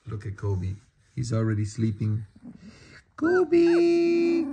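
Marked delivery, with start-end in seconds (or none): 3.2-4.5 loud high call to the dog. Real, not acted. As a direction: call the dog's name up high. Calling the dog loudly.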